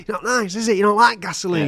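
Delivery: high, goading taunt. Acted. Torturer goading the man he's splashing, taunting.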